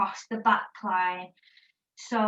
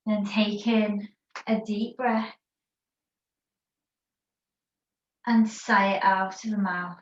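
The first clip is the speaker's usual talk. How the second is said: calm, unhurried yoga teacher voice guiding a rest pose; guided meditation; 3 semitones lower than usual. Acted. Yoga teacher guiding the class in child's pose, guided meditation voice. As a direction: ease the class into stillness, word by word.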